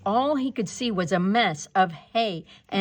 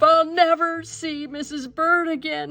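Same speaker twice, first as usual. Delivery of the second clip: higher, louder tearful lament; Mister Bird mourning, tearful and loud; crying; 8 semitones higher, 6 dB louder than usual. A narrator's reading. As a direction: cry it out in despair. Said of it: "he cried".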